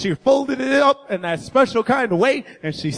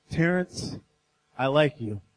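soft letdown; 12 semitones lower, 9 dB quieter than usual. Retold or imitated. Reading the breakup note.

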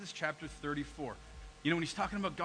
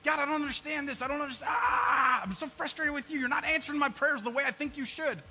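shouted, frustrated prayer, much higher and louder; angry complaint to God; 7 semitones higher, 5 dB louder than usual. Retold or imitated. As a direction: yell your frustration at God. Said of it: "how many times do we get angry".

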